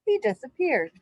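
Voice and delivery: ordinary delivery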